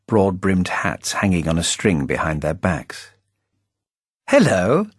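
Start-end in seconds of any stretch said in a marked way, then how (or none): 4.3-4.9 called gaily. A narrator's reading. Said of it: "I called gaily".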